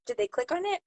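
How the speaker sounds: normal talking voice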